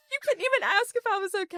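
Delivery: high whiny wail through laughter; mock-hurt protest. Wife imitating hurt husband after her teasing, mock wail. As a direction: imitate a wounded husband, hammed-up and half laughing.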